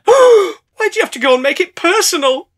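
high, slow offended whine; wounded whine. Acted. Bottle of water offended by Linda's insult, high hurt whine. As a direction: sound hurt and dramatic.

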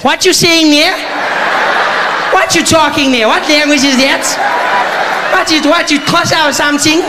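high, loud baffled woman's voice; woman puzzled by the comic's Hindi. Retold or imitated. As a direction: squeal in confused disbelief. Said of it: "She was like".

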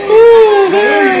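high, loud hammed-up "ooh, man"; mock outcry. The tempter cries out at the husband, in mock outrage. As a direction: cry out like you're appalled.

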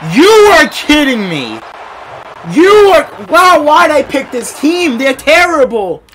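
loud, high, slow disbelieving shout; real angry outcry; outraged yell. Real, not acted. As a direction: rage at the fumble. Team fumbles the ball.

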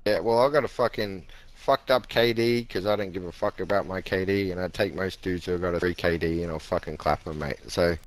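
usual speaking voice

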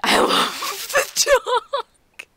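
high, loud, slow gushing; high excited squeal of love; delighted squeal. Real, not acted. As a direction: gush over the dog. Delighted at picking up dog.

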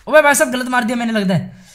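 high, loud startled cry; a sudden "oops!" blurted out loud; startled exclamation. Real, not acted. Hit wrong target by mistake.

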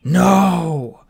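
loud high shriek. A narrator's reading. Captive dragon shrieking as the egg falls, loud and high. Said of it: "Hvatfar shrieked".

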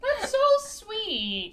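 high, loud and slow gushing; touched. Real, not acted. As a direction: gush over a sweet gesture. Touched by friend's gift.

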